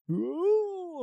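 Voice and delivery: mock trippy ooh. Describing others tripping on weed.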